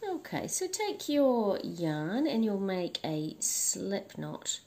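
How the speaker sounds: ordinary talk, nothing marked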